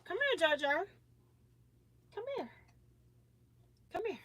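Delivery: coaxing a granddaughter to come over. Real, not acted. Calling the baby over.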